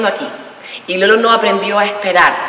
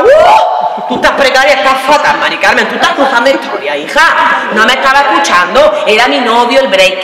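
high, loud comic rant; hammed-up exasperated yelling; indignant outburst; 4 semitones higher, 4 dB louder than usual. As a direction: rail at the therapist, voice shooting up. Acted outburst at her psychologist.

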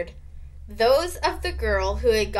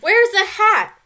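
high, loud urgent shout; 11 semitones higher, 9 dB louder than usual. A narrator's reading. Girl hunting for something to trap a mouse, shouting. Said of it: "someone shouted".

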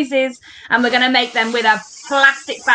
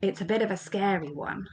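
low, soft warning; hushed suspense; 5 semitones lower, 13 dB quieter than usual. Pretend. Warning the children of the last character, hushed suspense. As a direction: lower and hush your voice, teasing a scare. Building suspense before the dragon appears.

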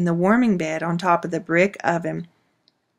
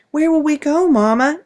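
put-on child's voice; 9 semitones higher, 5 dB louder than usual. Frightened child asks mother.

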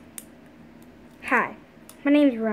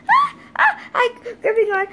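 straining cry; 9 semitones higher, 6 dB louder than usual. Pretend. Pulled away from desk, gripping.

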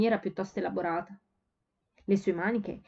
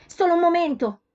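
much higher, much louder shout; shout over the din; 9 semitones higher, 8 dB louder than usual. A narrator's reading. Letty shouting to her sister over the din. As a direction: yell to be heard above the noise. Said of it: "gridò Letty per superare il frastuono".

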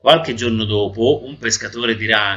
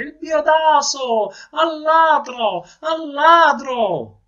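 high, loud, slow stuffed-nose cry; drawn-out shout; 5 dB louder, much slower than usual. Acted. Robbed man cries thief from the balcony, shouting high. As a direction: raise the alarm, stretching each word high. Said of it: "gridò il signore".

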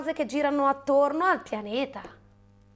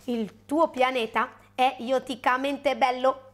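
slow, even alien voice; measured alien creature voice; Ioto alien; slower than usual. Acted. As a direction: speak slowly and evenly like a little alien. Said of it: "commentò uno Ioto".

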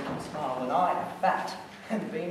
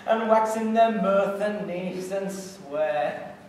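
loud, gleeful telling through laughter; laughing storytelling; 4 semitones higher, 8 dB louder, slower than usual. Acted. Puck tells how everyone held their hips, laughing. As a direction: laugh along, then draw the words out.

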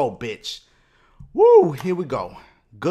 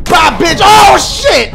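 loud high shout of alarm; panicked yell; 10 semitones higher, 10 dB louder, slower than usual. Real, not acted. Gamer fighting a tough boss, yelling in alarm.

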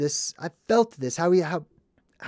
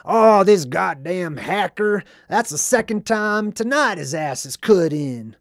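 slow, louder, higher gruff complaint; grumpy homeless guy; 4 semitones higher, 6 dB louder than usual. Retold or imitated. Vlogger imitating the irritated homeless guy, louder and gruff. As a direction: grumble like a cranky old bum. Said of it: "That's exactly how he sounds".

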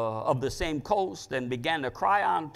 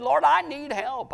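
raised, high-pitched plea; pleading prayer; 10 semitones higher, 5 dB louder than usual. Retold or imitated. Preacher voicing Solomon's prayer to the Lord, pleading. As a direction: plead upward, louder and much higher.

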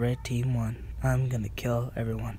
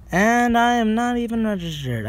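excited; 10 semitones higher, 5 dB louder than usual. Real, not acted. Boasting excitedly.

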